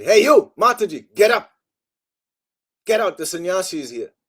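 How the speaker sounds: loud, high commanding shout; bossy shout. Retold or imitated. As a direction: bark the order across the room. Sannyasi ordering her out, retold.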